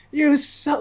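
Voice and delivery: sudden high jeering "You suck"; mocking crowd jeer. Fans turning on a famous hater, high jeer. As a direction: sneer it like a whole crowd piling on.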